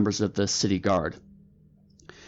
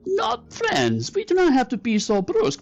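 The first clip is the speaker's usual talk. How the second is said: high, placating fake Korat accent; hammed-up Korat accent, pitched way up; Mister Meow; 10 semitones higher than usual. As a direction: soothe the guard in a high put-on Korat accent. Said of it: "fake Korat accent you've ever heard".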